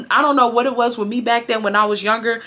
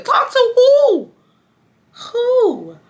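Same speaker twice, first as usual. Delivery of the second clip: drawn-out high, loud WHO; incredulous; 3 dB louder, much slower than usual. Retold or imitated. Teen asking mom who to talk to, incredulous. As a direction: re-enact disbelief, stretching the last word high. Shocked reply to mom re-enacted.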